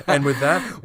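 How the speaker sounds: 笑いながら大声で締める; big laugh into the sign-off; laughing loudly. Real, not acted. Closing laugh.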